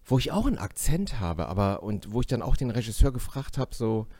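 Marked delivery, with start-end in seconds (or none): none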